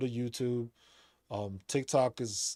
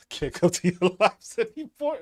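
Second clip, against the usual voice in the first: laughing; 5 semitones higher, 7 dB louder than usual. Real, not acted. Laughing through the line.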